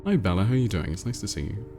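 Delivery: ordinary talk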